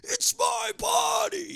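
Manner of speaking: high, slow shrieking yell of "it's my body"; mock scream. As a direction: screech it like a hammed-up tantrum. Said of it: "I fucking flipped out on him".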